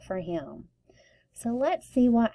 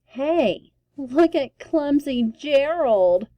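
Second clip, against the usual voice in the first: high, loud mocking jeer; taunting the clumsy giraffe; sneering; 5 semitones higher, 5 dB louder, slower than usual. A narrator's reading. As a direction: sneer it at him like a bully. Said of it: "the animals all sneered".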